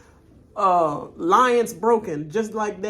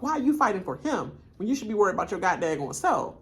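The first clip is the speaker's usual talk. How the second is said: usual speaking voice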